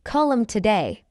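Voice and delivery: quick, linked "Call 'em today"; fast English. Shown as a demonstration. Teacher trains learners' ears, fast. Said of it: "fast English is not difficult".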